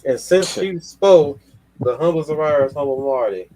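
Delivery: slow, high, loud announcer voice; grand introduction; announcer intro. Pretend. Host introduces guest like announcer.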